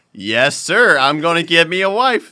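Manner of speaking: loud, rising mock enthusiasm; acted-out obliging agreement; eager playing along. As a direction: announce it gamely and loudly. Playing along with odd customer.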